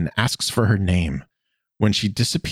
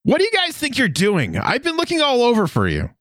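mock yell. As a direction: scold them in a high, exasperated voice. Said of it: "Sokka yells".

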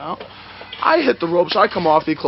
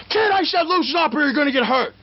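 high, strained mock yell; retold stern warning; 10 semitones higher than usual. Veteran warns the kid to loosen up, mock yell. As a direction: imitate an opponent's high-pitched threat. Acting out opponent's threat in hold.

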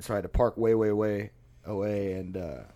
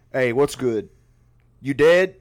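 louder, rising mock call; 3 semitones higher, 8 dB louder, slower than usual. Homeless guy teasing the man on the sidewalk, loud mock call. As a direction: yell it down at a guy on the ground.

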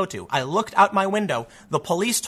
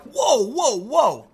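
mock startled whoa; 6 semitones higher, much slower than usual. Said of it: "I'm like".

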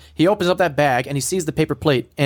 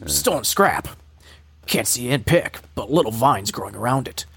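lightly voiced terse soldier, lowered at phrase ends; Snake; 4 semitones lower than usual. Host reading scrap captions, light Snake impression. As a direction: report the find in short, low phrases. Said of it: "I'm gonna go back to Snake".

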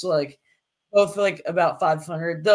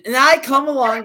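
ordinary delivery

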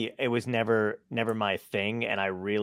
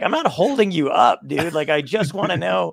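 higher and louder mock exasperation; retold irritated protest, pitched up; exasperated pushback; 5 semitones higher, 8 dB louder than usual. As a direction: push back, half-yelling, annoyed. Comedian pushing back at cashier.

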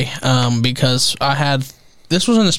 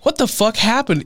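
put-on stunned kid; 9 semitones higher than usual. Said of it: "And I'm just like".

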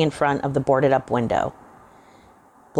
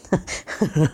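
put-on old man's laugh; 2 semitones higher than usual. A narrator's reading. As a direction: let the laugh take over. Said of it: "as he started to laugh".

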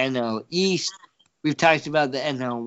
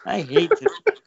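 burp-talk, words pushed out on a belch; 7 semitones higher, 5 dB louder than usual. Acted. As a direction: belch the line out, Rick and Morty style. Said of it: "That was a real Rick and Morty, like, burp talk".

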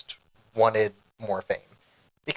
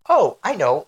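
sudden idea; 5 semitones higher, 7 dB louder than usual. Retold or imitated. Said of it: "so I was like".

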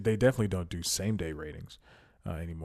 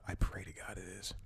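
hushed unvoiced whisper; 8 dB quieter than usual. Real, not acted. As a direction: murmur it under your breath. Whispered hope camera runs.

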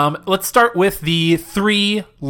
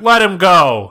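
resigned pursuer; 4 semitones higher, 6 dB louder, slower than usual. Retold or imitated. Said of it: "the guy was like".

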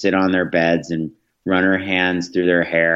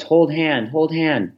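lightly voiced toddler, higher; a hint of a small child asking sweetly; imitating a toddler's simple request; one-year-old voice; 8 semitones higher, slower than usual. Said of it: "in her one-year-old voice".

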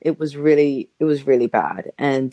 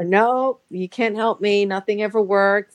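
higher, mock-whiny refusal; stubborn dismissal; 5 semitones higher than usual. Refusing her friend's help, mockingly whiny. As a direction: brush it all off with la la la. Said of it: "I kept saying to her".

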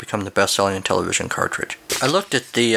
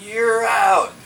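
umpire call; 10 semitones higher than usual. Acted.

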